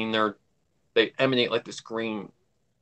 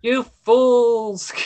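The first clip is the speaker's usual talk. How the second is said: mock villain; 8 semitones higher than usual. Quoting the villain to her co-host in a mock villain sneer.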